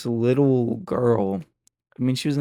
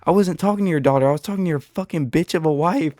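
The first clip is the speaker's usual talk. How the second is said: fast, loud retold yell; shouting back at the husband, fast and loud; 3 semitones higher, 4 dB louder, faster than usual. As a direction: fire it back at him quickly. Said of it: "And I'm yelling back at him".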